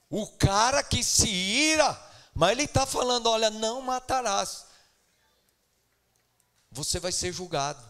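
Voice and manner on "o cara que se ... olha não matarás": fiery preaching. Acted. Preaching at full force.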